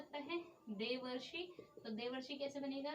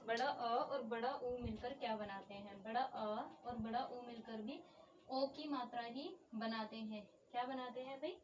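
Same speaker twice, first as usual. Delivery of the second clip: normal talking voice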